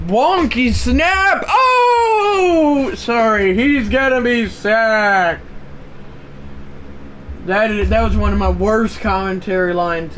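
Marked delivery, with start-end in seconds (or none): 0.1-5.4 high, slow excited announcer yell; excited play-by-play. Acted. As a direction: call the sack in a rising shout. Live play-by-play call.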